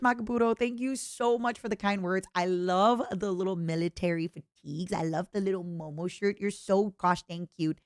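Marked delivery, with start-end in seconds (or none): none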